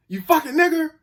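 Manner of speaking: imitated high-pitched angry outburst; angry insult. Retold insult from angry opponent.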